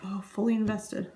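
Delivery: hushed whispered aside, playing the rapt listener; mock whisper. Shown as a demonstration. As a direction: breathe it as if fully absorbed. Showing a fully invested listener.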